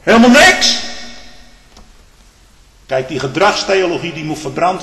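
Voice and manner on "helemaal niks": high, loud challenge; preacher roaring the point about Jacob and Esau; pulpit shout. Acted. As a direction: shout it at the congregation.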